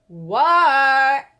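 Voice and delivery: loud, drawn-out answer back; called answer. Acted. Froggy answering his mother's call, loud. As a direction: shout back across the yard.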